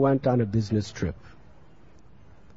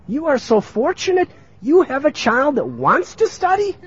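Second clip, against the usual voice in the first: very high, emphatic indignation; incredulous reproach; 11 semitones higher, 5 dB louder than usual. Acted. Townspeople scold mother for stopping studies, incredulous reproach. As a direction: berate her in a high, loud pitch.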